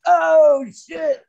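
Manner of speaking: high, loud burst of sudden shock; retold gasp of disbelief; shocked realization. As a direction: it just hit you, blurt it out. Realizing the World Trade Center.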